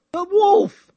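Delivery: "the Wolf" jumps high and loud; spring the punchline with a yelp; mock grand subtitle. Host mocks subtitle titles with a loud high yelp.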